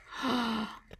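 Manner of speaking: short gasp of mock surprise; pretend gasp. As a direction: gasp as the next animal appears. Reveals the next animal.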